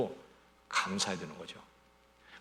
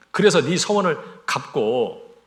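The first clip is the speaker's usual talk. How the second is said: louder, drawn-out scripture line; slow emphatic proclamation; 3 semitones higher, 9 dB louder, much slower than usual. Real, not acted. Preacher declaring the verse to the congregation, slow and loud. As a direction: proclaim each word slowly.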